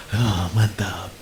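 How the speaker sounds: low, soft, slow sigh of relish; smug satisfaction. Acted. Preacher voicing the flesh relishing a won quarrel, smug. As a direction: savour it quietly, drawn out.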